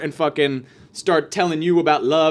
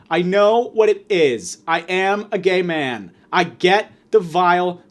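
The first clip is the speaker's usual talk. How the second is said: ordinary talk, nothing marked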